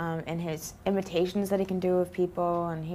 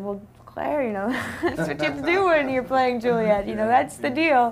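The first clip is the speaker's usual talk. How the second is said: higher, louder imitation of Baz, laughing through it; imitating the director; 7 semitones higher, 3 dB louder than usual. Actress retelling her director's reply, imitating him with a laugh. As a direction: lightly play the director brushing off her complaint. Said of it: "And he said".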